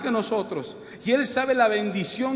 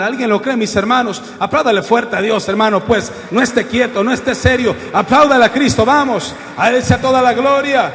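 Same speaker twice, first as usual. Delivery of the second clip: loud, rousing call to the congregation; full-force preaching; 9 dB louder than usual. Acted. Preacher urging the congregation to applaud Christ, full-force preaching. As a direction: preach it out, urging everyone to praise.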